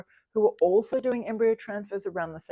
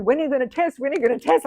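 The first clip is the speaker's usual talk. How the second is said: 1 pestering friends; 8 semitones higher, 8 dB louder, faster than usual. Retold or imitated. Voicing nagging community members.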